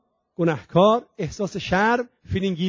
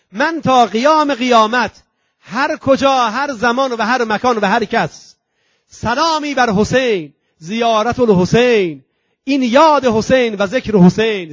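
slow, louder, soaring sermon delivery; preaching at full force; 5 semitones higher, 6 dB louder, slower than usual. Acted. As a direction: proclaim the angel's vow to the congregation. Preaches the angel's promise. (موعظهٔ پرشور، آهسته و بلند)

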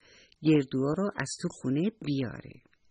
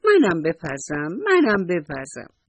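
higher, louder, slow and delighted; happy squeal; 4 semitones higher, 6 dB louder than usual. Acted. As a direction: squeal with a child's joy. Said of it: "موشی با خوشحالی گفت". (با خوشحالی و هیجان کودکانه)

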